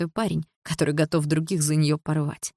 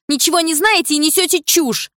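higher, louder retort; snapping back; 11 semitones higher, 7 dB louder than usual. A narrator's reading. Дилара snaps back at the woman, higher and louder.

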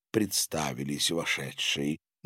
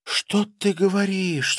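high-pitched astonished question; surprised; 11 semitones higher than usual. A narrator's reading. Wife hears about the war, surprised. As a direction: take in the news with amazement. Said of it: "с удивлением покачала головой она".

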